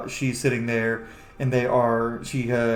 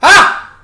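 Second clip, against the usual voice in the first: loud high happy cry; excited shriek; 16 dB louder than usual. Acted. Brother spotting Waffle, loud excited cry. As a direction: burst out with joy. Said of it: "he goes".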